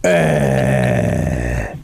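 very deep drawn-out grunt; Ben Affleck. Retold or imitated. Host mocks the actor's superhero voice, deep grunt. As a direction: imitate a superhero's low groan. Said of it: "like that".

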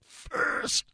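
strained, over-emphasized "first"; mock overly sincere emphasis; mocking imitation. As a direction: push out "first" with exaggerated effort. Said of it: "that's what she sounds like".

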